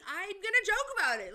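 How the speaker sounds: defiant. Real, not acted. Defiant emphasis.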